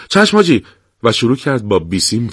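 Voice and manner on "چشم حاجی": quick, high eager yes; hurried. Acted. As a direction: snap to the order in a rush. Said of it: "شتاب زده گفت". (با عجله و صدای زیر)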